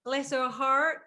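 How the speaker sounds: quick English attempt at "Bless her heart"; "Bless her heart" said at normal speed, Southern rise on "heart"; English try at Southern. Shown as a demonstration. Host tries the Southern phrase, plain and quick. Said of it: "No, no".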